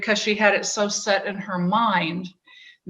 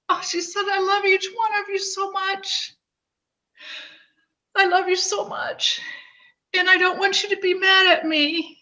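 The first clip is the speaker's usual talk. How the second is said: real tears, voice pitched much higher and choked; crying; 10 semitones higher, 3 dB quieter than usual. Real, not acted. Reading her sister's suicide note aloud to Frank, crying openly. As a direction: read her sister's goodbye note through sobs. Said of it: "I didn't anticipate crying like this".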